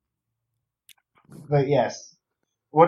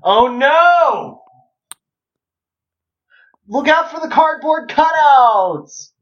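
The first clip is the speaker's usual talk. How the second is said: high mock-panicked cry; hammed-up fright, higher; mock scared; 10 semitones higher, 4 dB louder, slower than usual. Mock horror game warning.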